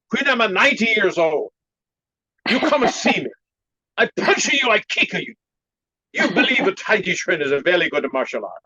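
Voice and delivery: put-on high, punchy Chinese-accented master; comic imitation of a feisty master's Chinese accent; funny Chinese-accent voice. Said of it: "I have to do his funny voice like that".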